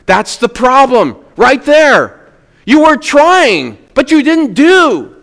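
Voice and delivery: forceful preaching. Acted. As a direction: preach it hard at the congregation.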